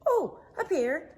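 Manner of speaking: high, fast, eager little gopher. Acted. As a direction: pop up keen and quick. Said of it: "Gopher answered".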